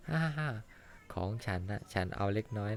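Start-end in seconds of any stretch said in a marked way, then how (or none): 0.1-0.5 high bright laugh. Acted. Gentle laugh while sharing food.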